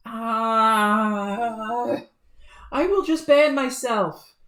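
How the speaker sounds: exasperated outburst. Acted. Jade fed up, leaving.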